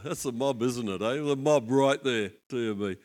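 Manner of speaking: ordinary talk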